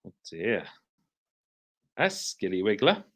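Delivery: ordinary delivery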